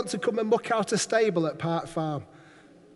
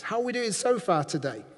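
usual speaking voice

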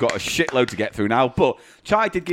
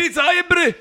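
high, loud mock Scots; pitched-up Scots character voice; mock Scottish character; 4 dB louder, slower than usual. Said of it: "It's my new character".

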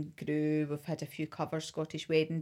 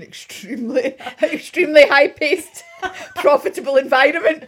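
laughing; 10 semitones higher, 16 dB louder than usual. Real, not acted. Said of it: "laughing at her past job".

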